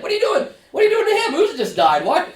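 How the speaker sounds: mock incredulous onlooker. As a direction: squeak out the shock through a laugh. Onlooker reacts to David.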